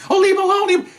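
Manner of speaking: mocking falsetto plea; protesting bystander. Bystander telling officers off, mocking high-pitched protest. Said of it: "people will say that to officers".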